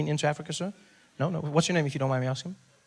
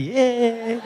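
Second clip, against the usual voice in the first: high, loud little cheer; happy cheer; 9 semitones higher, 6 dB louder than usual. Retold or imitated. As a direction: cheer it brightly on a high note.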